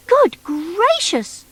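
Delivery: high, slow "good gracious"; surprised. Acted. Noddy hearing all the bunkie offers, slow surprised exclaim. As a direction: draw it out in amazement.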